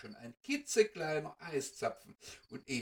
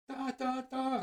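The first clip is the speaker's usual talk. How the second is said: viel höher, hastig gerufen; ganz aufgeregt; 11 semitones higher, 5 dB louder than usual. Acted. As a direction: zeigen und rufen wie staunende Kinder. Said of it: "ganz aufgeregt". (Mäuschen entdecken das Weihnachtsland, hoch und aufgeregt)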